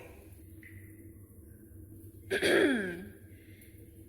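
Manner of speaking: a deliberate ahem to be noticed; attention-getting throat clear. Acted. Fox clears her throat to draw the spider's notice. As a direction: clear the throat to announce yourself.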